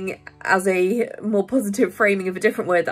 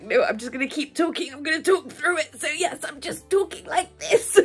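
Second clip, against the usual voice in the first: tight, holding-back-tears voice; 7 semitones higher than usual. Shown as a demonstration. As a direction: voice climbing higher and tighter, talking through held-back tears. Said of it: "your voice goes all tight".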